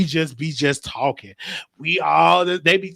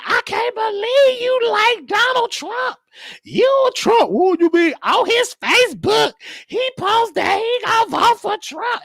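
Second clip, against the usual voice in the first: much higher, shouted, drawn-out imitation of the angry coworker; mock furious lady; slower than usual. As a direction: shriek it like the pissed-off coworker, hammed up. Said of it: "she was so pissed".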